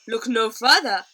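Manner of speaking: loud, proud showman call; proud boast. A narrator's reading. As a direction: announce yourself like a grand host. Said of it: "he said proudly".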